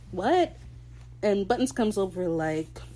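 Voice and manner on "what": high, rising puzzled "what?"; imitating a bewildered man; confused. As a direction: blurt it higher, baffled. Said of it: "and Steed's confused, like".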